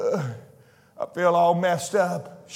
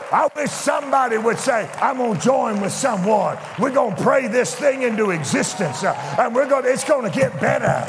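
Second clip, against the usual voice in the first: higher, fervent preaching voice; 6 semitones higher than usual. Acted. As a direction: stir them up with hope.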